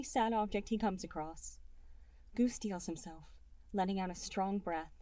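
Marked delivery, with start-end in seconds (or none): none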